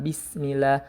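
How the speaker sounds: ordinary talk, nothing marked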